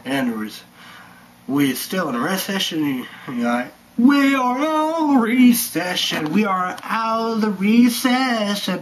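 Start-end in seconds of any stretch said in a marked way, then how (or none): 4.0-8.8 loud, high mock official claim; mock announcement. Vlogger mocking a politician declaring the recession over, loud. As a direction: proclaim it like a hammed-up politician.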